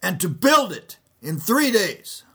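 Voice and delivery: high, loud accusing cry, slowing at the end; loud accusing witness. Acted. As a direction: shout the accusation across the court. False witnesses quoted in trial.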